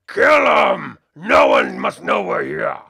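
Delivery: loud, snarling orc battle cry; shouting orc. Acted. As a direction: bark it like a brute warning his crew. Orc yells orders in battle.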